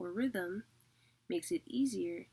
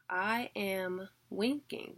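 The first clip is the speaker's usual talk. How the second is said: "I am winking" with "am" and "wink" hit harder; unstressed then stressed; slower than usual. Shown as a demonstration. Teacher gives students a mnemonic, stressing "am" and "wink". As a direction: say "I am winking at you" stressing "am" and the first syllable of "winking". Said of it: "teacher repeats mnemonic".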